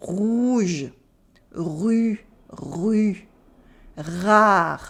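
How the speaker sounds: "rouge", "rue", "rare" with gargled R; French throat R. Shown as a demonstration. Teacher leading practice, uvular R in "rouge", "rue", "rare". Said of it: "Think of it as a gargling exercise".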